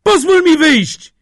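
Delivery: high, loud, drawn-out plea; trapped man's high cry; desperate shout. A narrator's reading. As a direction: yell to be let out. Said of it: "zawołał w skrzyni Kościelny".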